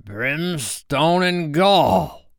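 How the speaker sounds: slow, high-pitched pirate oath; long-drawn thunderous exclamation; thundering. A narrator's reading. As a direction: drag out the curse in outrage. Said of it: "thundered Hook".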